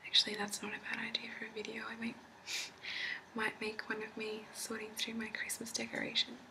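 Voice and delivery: ordinary talk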